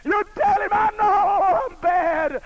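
loud, high, impassioned preaching; preaching at full force. Acted. Preacher to his congregation, retelling Job, full-force preaching. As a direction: thunder it out to the congregation. Preaching Job's and God's words.

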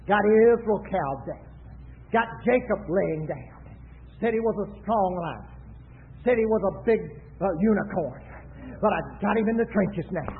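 a hint of the devil, in short low phrases; gloating devil. Preacher quotes the devil on cowed Israel, lightly voiced low gloat. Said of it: "The devil said".

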